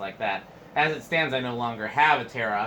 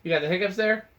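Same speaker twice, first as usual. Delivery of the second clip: higher and louder, asked again; louder repeat; 8 semitones higher, 4 dB louder than usual. Real, not acted. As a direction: repeat it so he hears you.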